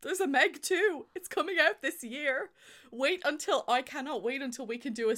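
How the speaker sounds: thrilled squeal of a fan; excited. Real, not acted. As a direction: bubble over with glee about the sequel. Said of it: "I'm so excited".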